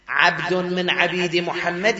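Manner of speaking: ordinary talk, nothing marked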